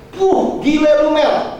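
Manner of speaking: high-pitched exclamation of disbelief; mock scolding shout. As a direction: act out an exasperated telling-off, drawn out. Brother-in-law scolding him in retelling. (seruan memarahi bernada tinggi)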